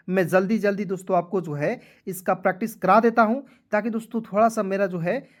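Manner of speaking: ordinary talk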